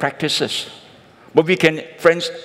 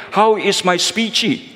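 mock pidgin; 6 semitones higher, slower than usual. Chinese guest mocks Brit back.

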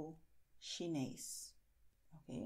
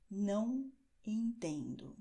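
slow and deliberate, falling at the end; teacher giving the equivalent phrase; slow model phrase; slower than usual. Shown as a demonstration. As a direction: repeat it slowly and clearly. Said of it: "A mesma coisa é".

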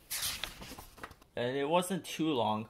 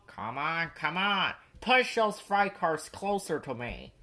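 bossy; 4 semitones higher than usual. A narrator's reading. Crane orders the engines to push closer, bossy. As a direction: hurry them along, bossily. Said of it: "he grew bossier still".

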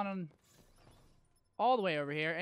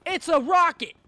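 high, loud outraged yell; indignant shout; 5 semitones higher, 9 dB louder than usual. Real, not acted. Gamer raging that a rocket did not kill. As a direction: shout your disbelief at the game.

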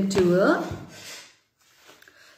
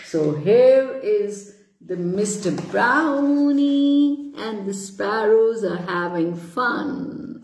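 ordinary delivery, nothing marked

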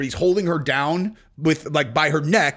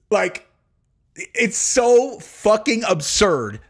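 ordinary talk

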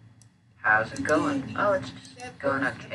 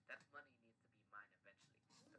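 usual speaking voice

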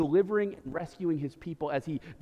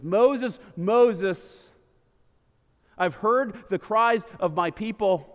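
calling out; 3 semitones higher, 5 dB louder, slower than usual. Acted. God calls out from bush.

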